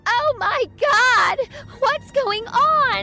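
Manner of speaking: high, loud, drawn-out panic; panicked scream. Acted. Girl finding a handful of her hair, screaming in panic. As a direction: scream it out in horrified alarm. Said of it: "I screamed in panic".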